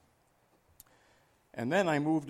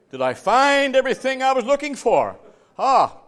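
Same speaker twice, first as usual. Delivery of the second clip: high, loud preacher's outburst; exclaimed rhetorical question; 8 semitones higher, 9 dB louder than usual. Acted. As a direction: seize on the question and throw it out loudly. Evangelist seizes on the question.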